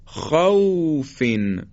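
خوفن drawn out, soft gliding واو; slow soft leen demo. Shown as a demonstration. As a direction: stretch خوفن gently.

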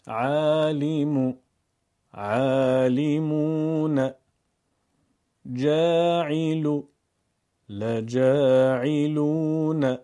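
short damma, long vav; Quran recitation. Shown as a demonstration. Teacher compares words with and without vav. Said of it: "چند کلمه مقایسه ای".

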